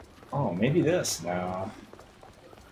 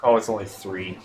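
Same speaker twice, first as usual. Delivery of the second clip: normal talking voice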